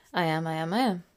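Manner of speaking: ordinary talk, nothing marked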